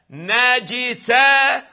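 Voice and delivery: "نجسة" as na-ji-sa, stretched syllable by syllable; kasra on jim. Shown as a demonstration. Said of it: "أما الجيم تكون ماذا؟". («نجسة» بفتح النون وكسر الجيم، ببطء)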